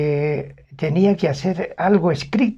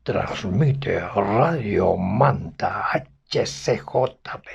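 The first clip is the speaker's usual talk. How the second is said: low, slow booming radio announcer; deep resonant radio call sign, drawn out slowly; big announcer voice; 7 semitones lower, much slower than usual. Retold or imitated. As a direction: boom it out like an old station ident. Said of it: "que tenía la gran voz".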